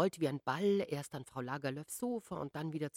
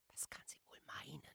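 a puzzled whisper, unvoiced; 18 dB quieter than usual. A narrator's reading. Aunt whispers to her sister-in-law at night, hushed. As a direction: breathe the question so as not to wake anyone.

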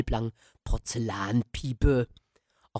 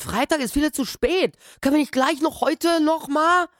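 put-on much higher, pleading young voice; begging the old man not to wait; boy pleads with the old caretaker, high and desperate; disappointed boy; 5 dB louder than usual. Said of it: "Ich war maßlos enttäuscht".

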